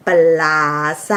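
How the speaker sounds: low, stretched-out mocking name-call; mock drawling jeer. Narrator imitates winners taunting the losing right wing, mock drawl. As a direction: draw the insult out long, imitating the jeerers. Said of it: "เว้าเสียงคางยันยาน".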